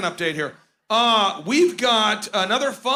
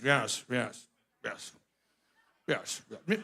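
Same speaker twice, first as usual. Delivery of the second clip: soft, lower nodding yeses; Pence impression; 9 semitones lower, 8 dB quieter, slower than usual. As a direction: quietly agree like a yes-man on stage. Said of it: "Pence is".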